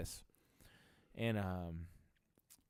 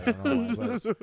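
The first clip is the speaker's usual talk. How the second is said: laughing; 13 semitones higher, 10 dB louder than usual. Real, not acted.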